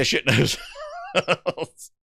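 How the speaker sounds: words breaking up into laughter; laughing. Real, not acted. Streamer explaining his crude translation to viewers, laughing. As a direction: crack up as the phrase ends.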